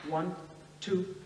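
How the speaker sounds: softly spoken, measured stern count. A narrator's reading. Husband counting for his wife as she pushes, understated stern. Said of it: "her husband sternly counting".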